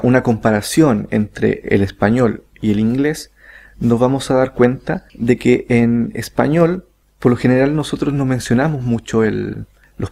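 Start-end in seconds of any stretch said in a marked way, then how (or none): none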